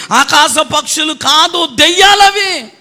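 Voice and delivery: high, emphatic declaration, slow start; Jesus declaring. Retold or imitated. Jesus rebuking the officers' hearts, high and emphatic. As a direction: proclaim it as a stern rebuke. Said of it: "అన్నాడు యేసయ్య".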